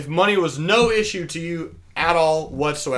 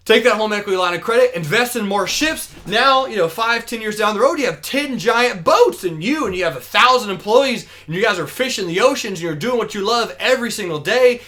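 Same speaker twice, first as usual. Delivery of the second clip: raised, louder salesman running through a grand vision; acted excited pitch; 6 semitones higher, 5 dB louder than usual. Businessman painting fisherman's big future, acted excited pitch. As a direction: keep piling on the promises with mock enthusiasm.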